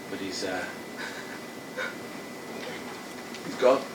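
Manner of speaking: somber condolence. Acted. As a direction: break the news gently. Officer delivering death news.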